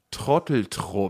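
low, slow, hushed quoted insult; quoting Thomas's insult. Retold or imitated. Retelling what Thomas shouted after them, low and slow. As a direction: drop the voice and drag out the insult. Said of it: "ich sage jetzt was, was Thomas gesagt hat".

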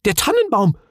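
high, loud alarmed cry; panicked outcry on realising; sudden panic. A narrator's reading. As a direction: realise it in a fright. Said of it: "vor Nervosität fast in Tränen".

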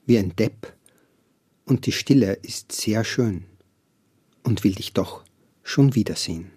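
measured poetry recital with long pauses between lines; poem reading. Shown as a demonstration. Poet reading his poem aloud on the host's request, measured recital. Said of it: "das vorlesen".

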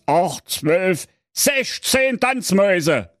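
put-on father lecturing his teenage daughter; stern father. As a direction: scold her like a know-it-all father. Father counts mice.

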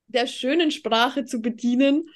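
ordinary talk

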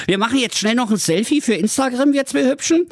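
high, fast and brash bragging; cocky prince voice. Acted. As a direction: gush like a selfie-crazy show-off. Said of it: "Und der Prinz rief".

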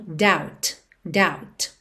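"doubt" said dawt, no B at all; "doubt" rhymes with out, B silent. Shown as a demonstration. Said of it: "mas o B não é pronunciado". ("doubt" sem pronunciar o B)

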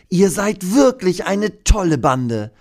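hohe, langsame Dinostimme voller Freude; gerührter Dino. Acted. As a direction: lob die Kinder begeistert. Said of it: "denkt er". (hohe, begeisterte Dinostimme)

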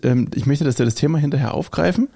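ordinary talk

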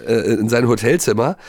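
usual speaking voice

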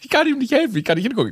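high, whiny can't-look panic; squeamish panic. Retold or imitated. Friend who can't look at the injury, high whiny panic. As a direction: plead it like you can't bear to see blood. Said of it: "sagt N".

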